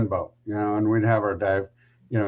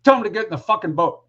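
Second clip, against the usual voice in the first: fast, higher, curt snapped order; sudden snap; 8 semitones higher, faster than usual. Retold or imitated. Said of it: "he just snaps instantly".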